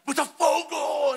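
slow, high shouted team name; mock fan shout. Preacher imitating a rival fan, drawn-out shout. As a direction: stretch the yell out like a rival fan. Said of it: "Ainda fez".

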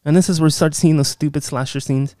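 ordinary delivery

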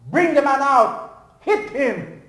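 loud, high, slow angry call; angry shout. Acted. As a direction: shout to throw him out. Relatives shout at preacher.

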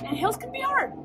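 high mock whine. Dramatic complaint about hills.

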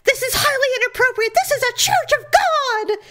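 high-pitched indignant scolding; outraged church member. Retold or imitated. Outraged church member catches the couple.

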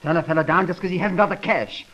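jilted man shouting at the operator; furious tirade. Acted. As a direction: let the rage boil over. Jilted lover rages at operator.

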